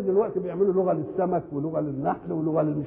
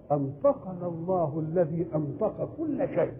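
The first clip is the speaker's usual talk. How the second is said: slow Quranic recitation; 4 dB quieter than usual. Acted. Quran verse recited slowly.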